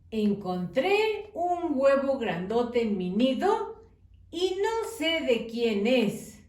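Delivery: put-on slow storytelling for children, lightly lilting; reading to children. A narrator's reading. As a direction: read slowly to little ones, with pauses. (maestra leyendo un cuento a niños, con calma)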